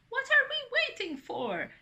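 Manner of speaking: high, loud, excited question; rally everyone impatiently, voice way up; eager grandmother. A narrator's reading. Grandmother woken by laughter, eagerly urging.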